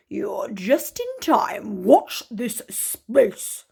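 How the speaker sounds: slow, sly wolf voice; drawn out like a scheming wolf. Acted. The wolf welcomes the visitor, slow and sly.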